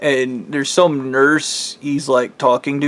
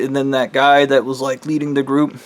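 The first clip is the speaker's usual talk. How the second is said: ordinary talk, nothing marked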